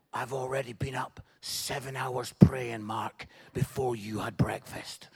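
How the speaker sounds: very low, creaky intense voice. Acted. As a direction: play an over-intense, pious student, pitched right down. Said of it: "intensely at you".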